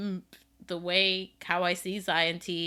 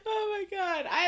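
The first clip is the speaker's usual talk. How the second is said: high, squeaky tail of a laugh; laughed-out exclamation; 12 semitones higher than usual. Real, not acted. Co-host reacting to the cartoon joke, weak with laughter. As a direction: recover from a real laughing fit. Recovering from shared laughing fit.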